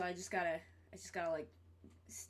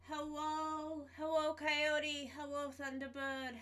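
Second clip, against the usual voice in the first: higher, sing-song greeting to chat; stream greeting voice; 5 semitones higher, 3 dB quieter, slower than usual. Acted. Greeting viewers at stream start, in a higher put-on greeting voice. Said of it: "I gotta do that voice every time I start stream".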